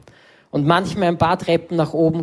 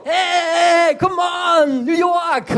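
hyped shout; 12 semitones higher, much slower than usual. Retold or imitated. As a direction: yell it like a cheerleader who won't quit. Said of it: "Dann alles so".

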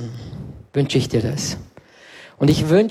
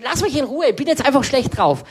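grumpy retort; 7 semitones higher than usual. Retold or imitated. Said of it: "sag ich".

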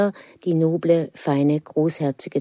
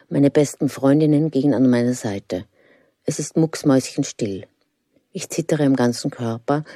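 ordinary delivery

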